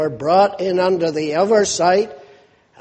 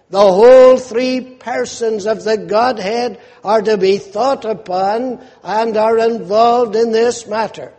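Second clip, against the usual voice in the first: higher and louder sermon delivery; full-force preaching; 4 semitones higher, 5 dB louder than usual. Acted. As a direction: drive the point home from the pulpit.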